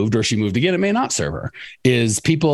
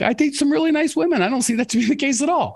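imitating his younger self, pitched way up and naive; mock innocent self. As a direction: play it wide-eyed, voice much higher. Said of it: "I'm like".

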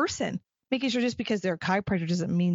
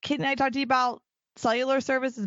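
higher, bright mock salesperson greeting; cheery door-to-door pitch; 5 semitones higher than usual. Young saleswoman knocking on business doors, a chirpy higher pitch. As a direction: play your younger self knocking on office doors. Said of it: "and go".

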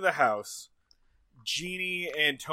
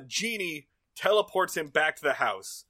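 ordinary talk, nothing marked

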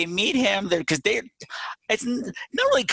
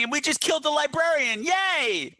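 much higher sarcastic yay; mock cheer; 11 semitones higher than usual. Viewers high-fiving over the dead librarian, much higher mock cheer. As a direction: cheer like a clueless fan.

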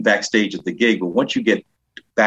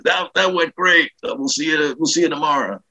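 high-pitched, hurried-off praise called across backstage; George Carlin; 8 semitones higher than usual. Retold or imitated. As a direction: imitate an approving headliner. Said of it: "So he goes".

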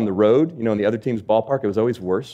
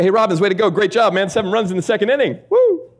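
high, loud hollow cheer ending in a whoop; mock cheering; 9 semitones higher, 4 dB louder than usual. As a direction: fake cheer for a terrible outing, ending in a big whoop. Imagined teammates' fake praise.

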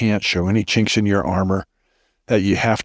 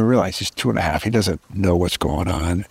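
ordinary talk

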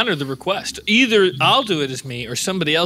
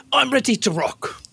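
higher-pitched put-on British accent; British-accented survival host, pitched up; Bear Grylls, British accent; 7 semitones higher than usual. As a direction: play an eager British adventurer. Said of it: "I just heard your accent".

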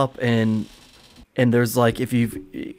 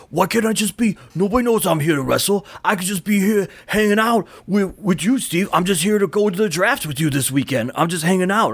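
Eddie arguing; 7 semitones higher than usual. Retold or imitated. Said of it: "his argument is".

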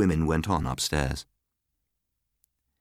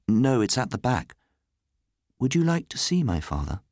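lightly voiced child, pitched up; young Nicholas; 6 semitones higher than usual. A narrator's reading. Boy offers to show Miss Grey his father, high-pitched. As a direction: ask innocently in a higher child's voice.

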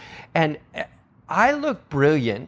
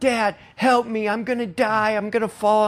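higher, hammed-up scared child; mock panic; 9 semitones higher than usual. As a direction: imitate a kid stuck up high, half-panicked. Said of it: "they're freaking out".

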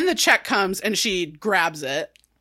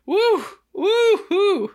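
high, whooping woo woo woo; mock cheer; 9 semitones higher, 3 dB louder, much slower than usual. Real, not acted. Cheering Jen on for her postcoital nap, whooping high.

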